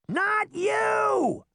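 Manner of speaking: very high-pitched shout; shrieked boyish correction. A narrator's reading. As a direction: yell it up high, impatient. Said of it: "Puck shouted".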